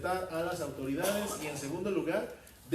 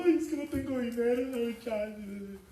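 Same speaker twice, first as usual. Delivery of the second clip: high, pleading tenant voice, slow at the end; mock whiny tenant pleading poverty to the landlord; whiny plea; 7 semitones higher, 4 dB louder than usual. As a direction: play the tenant begging to be let off, high and drawn out. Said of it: "le hizo la chillona".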